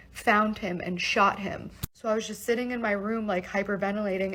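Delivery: normal talking voice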